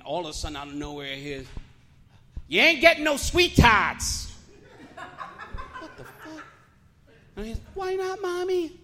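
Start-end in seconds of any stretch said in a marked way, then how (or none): 2.5-4.3 high, loud, slow scolding shout; angry mother yelling. Retold or imitated. Mother to seven-year-old in Family Dollar, angry shout. 5.8-6.4 tiny high whisper-soft gasp of disbelief; hushed shock. Retold or imitated. Shopper overhearing a yelling mother, hushed shock. 7.7-8.7 put-on very high, drawn-out little kid voice; seven-year-old child. Child to mother refusing sweets, high childish plea. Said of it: "you're seven years old".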